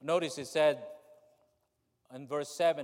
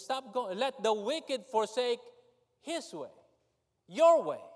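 preaching plea; 7 semitones higher, 6 dB louder than usual. Acted.

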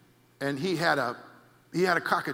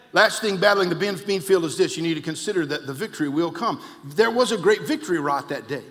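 full-force preaching; 5 semitones higher, 10 dB louder than usual. Acted. Preacher declaring victory to the congregation, building to a shout. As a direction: hammer each line harder than the last.

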